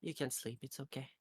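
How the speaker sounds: soft, quiet reassuring words; quiet reassurance. Acted. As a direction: hush them gently to sleep.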